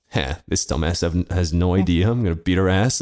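low-pitched aside to himself; cocky inner thought. Retold or imitated. Player privately underestimating his opponent, low cocky thought. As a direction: drop low, privately sizing up a rival. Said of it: "I have this thought".

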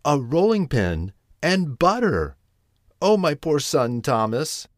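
high-pitched motherly dismay; distressed exclamation. A narrator's reading. Tabitha fears for poor Thomas, distressed exclamation. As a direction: cry out in storybook alarm, falling at the end. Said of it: "exclaimed Tabitha, wringing her paws".

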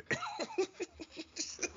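high burst of laughter. Real, not acted. Big laugh at the banter.

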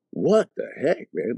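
high, blown-away exclamation; retold astonishment. Man looking around at everybody on the ground, astonished. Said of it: "I'm blown away".

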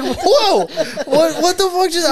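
high, loud, laughing disbelief; shocked laughing whoa. Retold or imitated. Teacher stunned by a kid's curse, high laughing shock. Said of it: "I'm like".

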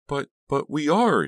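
very high, loud, panicky sputter. A narrator's reading. Dina protesting to Farberson, sputtering. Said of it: "Dina sputtered".

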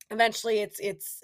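ordinary delivery

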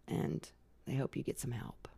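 hushed aside. Retold or imitated. Quoting officers' remark.

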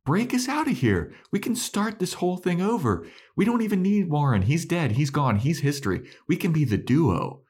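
high-pitched, urgent sales pitch; eager pleading. Retold or imitated. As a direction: plead excitedly, imitating a desperate schemer. Acting Andrew's excited pleading pitch.

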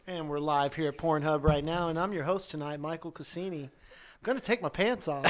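normal talking voice

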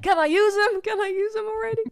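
loud high squeaky voice; silly high voice. Pretend.

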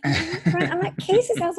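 high, wide-eyed innocent voice playing it cool; mock innocent. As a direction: squeak out an innocent fib to dad. Teen lying to dad on phone.

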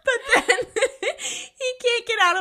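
high, helpless laughing speech; real laughter. Real, not acted. Co-host recapping Freddy stuck in cables, laughing hard. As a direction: squeeze the words out while cracking up.

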